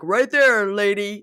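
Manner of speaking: loud jeering impression; acted retort. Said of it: "going like".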